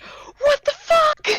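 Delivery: panicked. Acted. Panicked character reacting to creature.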